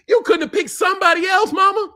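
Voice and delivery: hammed-up shout at mama; mock yell at mama. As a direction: call out to mama like a hurt child. Yelling at mama in mock grief.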